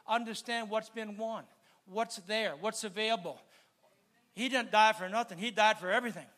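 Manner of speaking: ordinary talk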